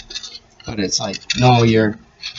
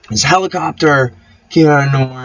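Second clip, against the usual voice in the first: normal talking voice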